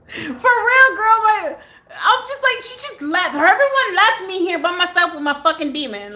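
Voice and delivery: high, half-crying, half-laughing whine; laughing distress. Real, not acted. Telling the viewer she was left alone, high laughing wail.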